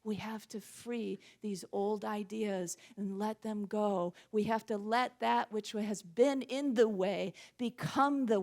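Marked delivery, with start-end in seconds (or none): none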